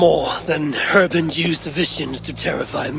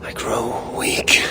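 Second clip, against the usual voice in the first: weary whisper. Acted. Exhausted after fight.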